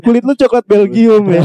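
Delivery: high, laughing teasing; laughing tease. Real, not acted. Ledekan sambil tertawa. (meledek sambil tertawa)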